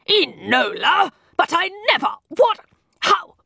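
high, broken-off astonished questions; can't finish a sentence for surprise; sputtering shock. A narrator's reading. Mycroft stunned by his disguised sister, sputtering high.

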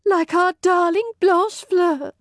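high, wailing lament; distraught lady's maid. Acted. Maid telling the visitor of the disappearance, distraught. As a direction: mourn the vanished mistress up high.

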